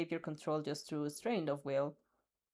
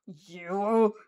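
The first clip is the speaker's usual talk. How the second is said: growl; 7 dB louder than usual. A narrator's reading. Bakugo growling at his captor, loud and angry. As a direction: bite the word out as a threat. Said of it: "he growls".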